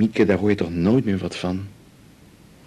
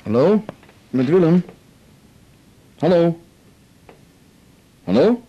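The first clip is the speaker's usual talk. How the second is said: raised, higher hallo into a silent line; calling into phone; 6 semitones higher, 6 dB louder than usual. Acted. Husband calling into the phone at night, raised and questioning. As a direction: call out louder and higher, unsure anyone is there.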